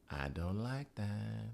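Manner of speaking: slow, hushed, drawn-out complaint; mock disapproval. Recapper imitates his reply to her, mock disapproval. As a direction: stretch it out softly, mocking him.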